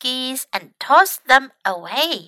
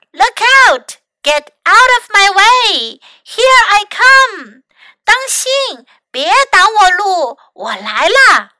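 loud, high warning calls; shouting; 5 semitones higher, 6 dB louder than usual. A narrator's reading. Mac warns everyone out of his way, shouting. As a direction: holler each warning with full force. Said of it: "麦克大喊道".

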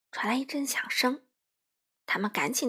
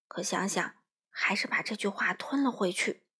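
usual speaking voice

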